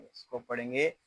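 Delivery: ordinary delivery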